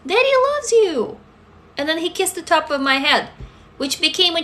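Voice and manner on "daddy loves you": high, sugary mock father voice; mimicking father. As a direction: imitate his fake sweetness, high. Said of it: "and he said".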